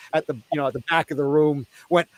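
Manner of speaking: ordinary delivery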